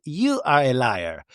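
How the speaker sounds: ordinary talk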